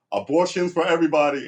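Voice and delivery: loud, high rally-speech announcement; cartoon politician. Simpsons candidate announcing to the crowd, loud and high. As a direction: proclaim it like a candidate at a podium. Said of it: "one goes".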